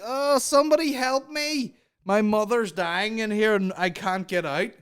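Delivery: high, loud imitation of desperate cries; hammed-up woman calling for rescue; mock plea for help. Acts woman begging from balcony.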